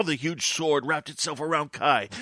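ordinary talk, nothing marked

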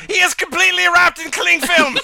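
high, loud exclaimed catchphrase; catchphrase shout. Shown as a demonstration. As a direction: announce it with gusto. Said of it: "Each story contains the sentence".

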